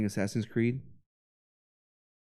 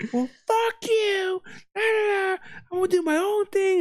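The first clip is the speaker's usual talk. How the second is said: mock arguing woman. Imitating the neighbor lady mid-argument, high and mocking. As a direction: squeak it out like a petty fight.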